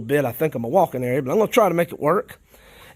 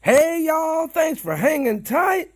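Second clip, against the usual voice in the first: frog in throat; 11 semitones higher, slower than usual. Real, not acted. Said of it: "I got a frog in my throat".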